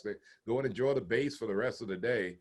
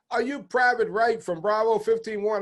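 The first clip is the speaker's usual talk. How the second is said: higher, louder call across the porch; retold yell; 6 semitones higher, 4 dB louder than usual. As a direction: call out across the yard to the private. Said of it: "he yelled over me".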